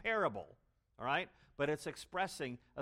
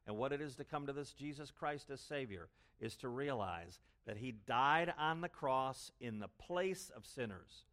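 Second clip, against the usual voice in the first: usual speaking voice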